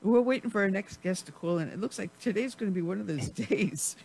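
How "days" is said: ordinary talk, nothing marked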